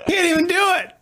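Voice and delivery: mock helpless child fretting over a test; flustered struggling kid. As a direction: play the kid panicking at the clock. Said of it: "I'm like".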